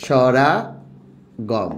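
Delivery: drawn out very slowly for notes; slow dictation. Shown as a demonstration. Teacher dictating the term to students, slowly drawn out. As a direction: stretch the word for the class. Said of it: "অন্ত".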